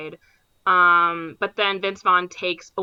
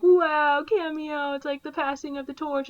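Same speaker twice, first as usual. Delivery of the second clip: higher, hammed-up wonder; mock awe; 7 semitones higher than usual. Mocking the movie's cameo with higher fake awe. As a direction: gush sarcastically over the cameo.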